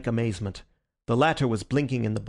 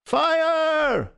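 loud, very high, held yell; drawn-out cry of alarm; ringing shout; 5 dB louder than usual. A narrator's reading. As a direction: shout it so it carries across town. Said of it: "The shout must have rung".

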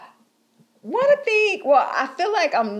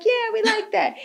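put-on high childlike voice; 12 semitones higher than usual. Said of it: "And we're like".

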